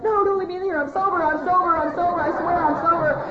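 loud, high-pitched frantic pleading; hammed-up wail, begging to be believed; panicked begging. Jailed daughter begging family on the phone, panicked high wail. Acted begging from jail, over dad's screaming.

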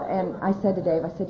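normal talking voice

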